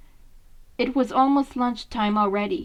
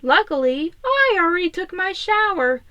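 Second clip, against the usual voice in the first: higher, smug little brag; smug remark; 7 semitones higher, 4 dB louder than usual. Acted. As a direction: tease the others who have to wait. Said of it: "Leo said".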